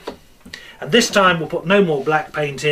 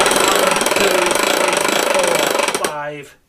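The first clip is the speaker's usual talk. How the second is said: loud counting; 4 semitones higher, 5 dB louder, much slower than usual. Acted. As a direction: count to five loudly and high. Counting the stir aloud.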